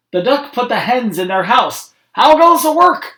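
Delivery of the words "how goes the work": higher and louder farmer call; bossy farmer shouting from bed; lazy farmer calling. A narrator's reading. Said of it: "called the farmer".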